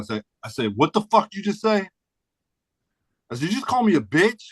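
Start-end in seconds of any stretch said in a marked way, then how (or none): 0.8-1.9 high, indignant challenge; retold angry retort. Retold confrontation over insult. 3.5-4.4 high, indignant challenge; retold angry retort. Retold confrontation over insult.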